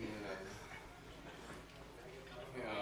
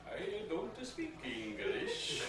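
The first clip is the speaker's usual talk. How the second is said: mock Dutch accent, high and slow; dumb foreign accent; 8 semitones higher, 6 dB quieter than usual. Clerk repeating his refusal in a mock Dutch accent. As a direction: drag out the clerk's broken English. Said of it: "in this really dumb accent".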